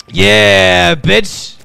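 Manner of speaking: loud, high victory shout; triumphant whoop. Real, not acted. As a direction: crow over the win. Celebrates building the suit.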